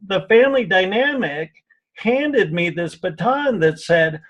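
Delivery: normal talking voice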